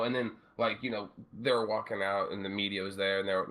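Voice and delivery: normal talking voice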